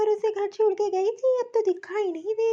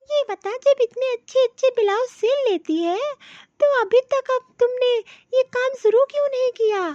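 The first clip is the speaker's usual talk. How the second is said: louder, eager and thrilled; excited praise; 3 semitones higher, 6 dB louder than usual. Acted. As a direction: urge her on, delighted by what she sees. Aunt thrilled by her sewing talent. (उत्साह से ज़ोर देकर तारीफ़)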